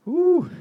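loud, very high "woo"; high whoop. Real, not acted. Reacting to a very strong beer, high whoop. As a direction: let out a held high whoop.

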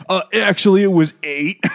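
loud, high mock correction mid-fight; eighties action hero. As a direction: chime in over the punches. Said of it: "action movie conversations from the eighties".

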